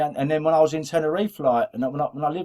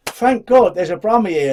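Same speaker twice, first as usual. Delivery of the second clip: loud relieved exclamation; 6 semitones higher, 7 dB louder than usual. Retold or imitated.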